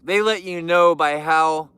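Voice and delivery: normal talking voice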